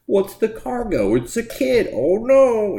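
hammed-up action hero. Reviewer mocking the comic's cliché plot twist, hammy. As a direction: overplay the cliché twist like a cartoon. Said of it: "doing the whole, like".

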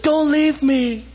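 put-on high, slow whimpering plea from a left child; high drawn-out childish whine; child's plea. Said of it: "It's like".

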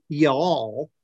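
imitated drawn-out Southern y'all; lightly put-on Southern drawl; Southern accent. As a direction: stretch the vowel like a Southerner. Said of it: "Oh, they're from the South".

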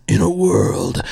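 deep, slow trailer narrator; movie trailer voice. Retold or imitated. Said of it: "they come up with a trailer for that".

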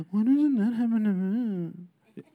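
slow, mumbled mock whine; whiny. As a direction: put on a slow, self-pitying whine. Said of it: "Are you whiny?".

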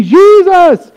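high, loud crowd shout of "Jesus!". Pretend. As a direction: call out the name like a cheering crowd. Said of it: "People are shouting".

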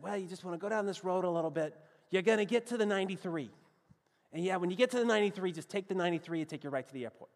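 imitating a fast-talking Bostonian; Boston local accent. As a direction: rattle off directions like a Boston local. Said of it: "here were the directions they gave me".

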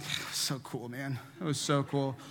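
low, soft feigned nonchalance; understated mock-cool murmur; playing it cool. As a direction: play it off quietly as no big deal. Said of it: "trying to play it off like".

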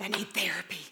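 breathy whispered confession. Retold or imitated. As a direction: whisper it like a secret admission. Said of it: "And now I'm like".

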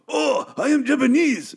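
slow, high-pitched caricature Japanese accent; deliberately unconvincing, hammed-up Japanese accent; badly faked Japanese accent. Shown as a demonstration. Podcaster showing an unconvincing impression, exaggerated fake Japanese accent.

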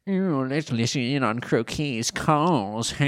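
slow, drawn-out cartoon villain voice; hammed-up schemer, deliberately silly; Bakura. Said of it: "he sort of looks at Croquet and goes".

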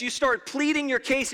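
ordinary talk, nothing marked

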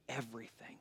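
dramatic low whisper; hushed gravity. Real, not acted. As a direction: drop to a whisper for weight. Dramatic climax of the message.

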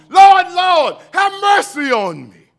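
loud, high pleading cry; preaching at full force. Acted. Preacher acting out crying to God, loud plea.

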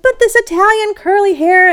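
high, loud indignant pleading; indignant protest. Retold or imitated. Artist protesting to the arts committee about the hair, high indignant. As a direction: squeak out an outraged defence of the curls. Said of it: "And I'm like".

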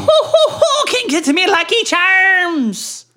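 very high, loud cartoon leprechaun; slow, high mock Irish leprechaun. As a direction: squeak it like a Lucky Charms leprechaun. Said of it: "sound like a leprechaun".

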